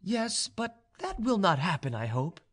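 high-pitched feminine reassurance; young noblewoman. A narrator's reading. Vaninka answers the suitor's fear of refusal, high-pitched.

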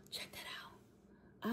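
slow, hushed whisper; whispered aside. Real, not acted. Showing viewers the milk, whispered aside. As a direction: whisper it slowly, barely there.

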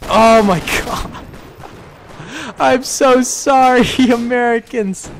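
high, loud, laughing apology; mock lament. Gunner to Americans he is killing, mock anguish.

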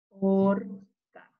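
"Orca" with long "or", a gap, then "ca"; sounding out. Shown as a demonstration. Teacher reading with children, "Orca" syllable by syllable. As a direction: split "Orca" into two drawn-out syllables. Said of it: "¿Me ayudan a leer?".

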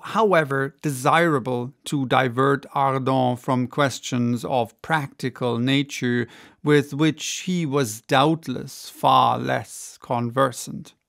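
ordinary delivery, nothing marked

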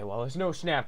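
normal talking voice

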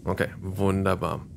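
ordinary talk

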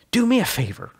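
Sully's eager voice. Acted. Sully urging Calla to run away with him, excited and high. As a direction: push the plan on her, breathless with hope. Sully asks a last favor.

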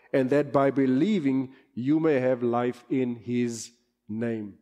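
measured Bible reading, falling to a hush at the end; scripture reading. Acted. Pastor reading scripture to the church, measured and solemn. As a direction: read the verse solemnly to the congregation.

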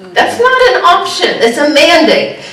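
hammering home that it's a mandate; emphatic declaration. Real, not acted. Preacher to congregation, loud laughing emphasis. Repeats the mandate line with force.

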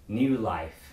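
normal talking voice

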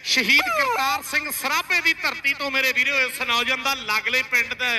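ordinary talk, nothing marked

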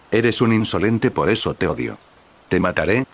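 plain reading voice

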